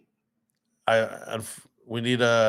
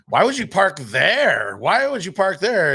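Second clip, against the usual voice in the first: nagging wife impression; 8 semitones higher, 4 dB louder than usual. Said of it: "his wife's always nagging".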